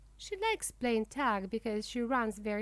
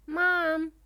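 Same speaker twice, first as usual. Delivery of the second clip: higher, louder call for Mom; crying out; 5 semitones higher, 7 dB louder than usual. A narrator's reading. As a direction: call out to a parent, drawn out. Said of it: "cries Smiley".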